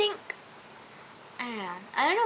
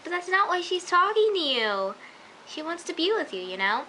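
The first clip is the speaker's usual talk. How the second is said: pleading with Leo; 7 semitones higher than usual. Retold or imitated. Fan pleading with a TV character, higher and urgent. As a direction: beg him to see she still wants him.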